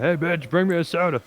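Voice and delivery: half-hearted joke holler for a drink; mock yell. Co-host jokes about summoning the fiancee, mock yell. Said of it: "kinda yell out".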